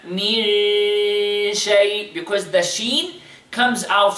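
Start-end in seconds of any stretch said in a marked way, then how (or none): 0.1-2.1 من شيء with a slow nasal hidden noon. Shown as a demonstration. Teacher models the ikhfa in من شيء. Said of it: "Notice how we pronounce again the إخفاء".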